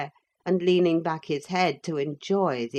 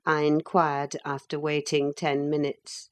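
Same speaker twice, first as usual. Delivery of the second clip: ordinary delivery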